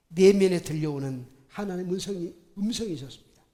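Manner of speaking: ordinary talk, nothing marked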